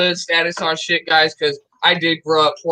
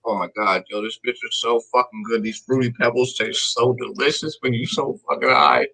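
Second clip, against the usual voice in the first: chewing cereal while talking; eating cereal; 3 semitones lower than usual. Real, not acted. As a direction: mouth full, relaxed and munching. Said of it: "I am eating a bowl of cereal right now".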